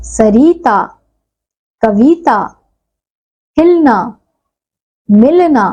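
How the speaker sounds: each word set apart, clearly sounded, with pauses; pronunciation drill. Shown as a demonstration. Teacher models each ई-matra word for the children, one by one. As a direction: read each word out slowly for the class to repeat. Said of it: "वो हम इन शब्दों का उच्चारण करके सीखेंगे".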